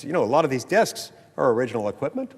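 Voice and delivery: usual speaking voice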